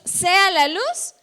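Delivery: orden divina, lenta, fuerte y más aguda; voz de Dios. Acted. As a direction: proclama la creación con autoridad, alargando las palabras. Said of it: "Dice que dijo Dios". (voz de Dios que ordena)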